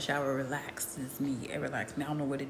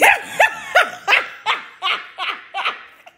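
loud, high burst of laughter; cracking up; big laugh; 10 dB louder than usual. Real, not acted. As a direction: laugh out hard at the absurdity. Laughing at son's behavior.

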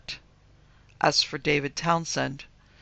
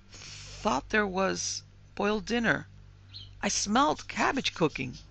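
uneasy; 6 semitones higher, slower than usual. A narrator's reading. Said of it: "her face paling".

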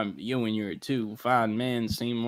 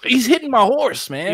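loud, high-pitched protest; frantic, raised voice; panicked complaint; 12 semitones higher, 10 dB louder than usual. Acted. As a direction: report the attack in alarm. Complaining about attack on horse.

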